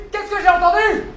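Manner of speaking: indignant demand. Acted. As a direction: demand it, voice climbing in outrage. Patron indigné, question répétée plus fort. (question indignée qui monte)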